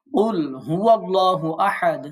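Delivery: slow chanted recitation; Quran recitation. Shown as a demonstration. Teacher reciting the ayah in slow chant. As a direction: chant it slowly like tilawat. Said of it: "Quran recitation".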